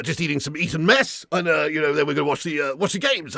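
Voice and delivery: low, dithering, full of uh and um; Boris Johnson impression. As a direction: bumble through it like a flustered politician. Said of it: "Boris Johnson".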